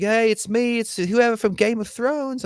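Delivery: high, slow, put-on celebrity ad voice; mock ad voice. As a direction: ham up a cheesy ad pitch. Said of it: "and he's like".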